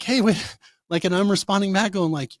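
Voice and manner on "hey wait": high, louder startled hey wait; startled protest. Retold or imitated. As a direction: jump in, alarmed and higher. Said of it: "And I'm like".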